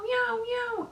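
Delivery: high slow crying mews; cat meows. Acted. Catbird calling its babies, high slow mews. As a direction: mew like a lost kitten. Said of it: "the bird went meow".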